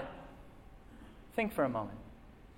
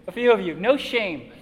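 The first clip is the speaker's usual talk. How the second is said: usual speaking voice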